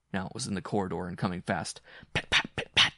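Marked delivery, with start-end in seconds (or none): 2.1-2.9 loud, percussive pit-pat; footsteps almost here. A narrator's reading. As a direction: imitate paws rushing right up. Footsteps rushing up, imitated.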